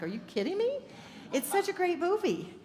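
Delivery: high-pitched disbelief; incredulous. Retold or imitated. Friend never saw the movie, mock disbelief. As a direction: react as if it can't be true.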